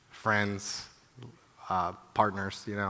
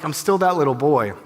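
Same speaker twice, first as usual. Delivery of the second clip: usual speaking voice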